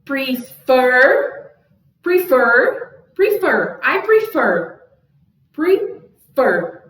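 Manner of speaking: prefer climbing to a loud, big FER; exaggerated stress on fer. Shown as a demonstration. Teacher drawing a vocal graph, exaggerated stress on fer in prefer. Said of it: "como se fosse um gráfico".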